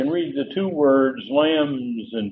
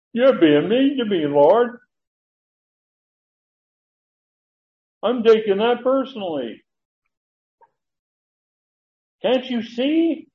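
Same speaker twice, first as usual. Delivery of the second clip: hurt Peter; 7 semitones higher, 3 dB louder than usual. Acted. Peter voiced protesting to Jesus.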